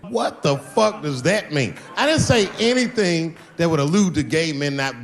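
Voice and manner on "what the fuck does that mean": ordinary delivery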